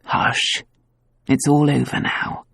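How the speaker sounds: quiet her gently; husband hushing his tearful wife. Acted. Husband soothing crying wife.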